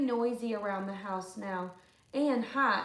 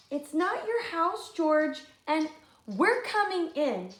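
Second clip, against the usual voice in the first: put-on high, firm children's voice; defiant girls; 5 semitones higher, 4 dB louder than usual. Lindy and Marley telling George they're coming in, high and firm. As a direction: stand up to the bully.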